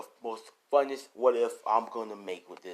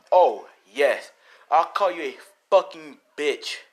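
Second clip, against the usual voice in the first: loud, higher, emphatic retort; acted defiant anger; 4 semitones higher, 12 dB louder, slower than usual. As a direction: fire the insult back defiantly. Izuku defiantly repeats insult.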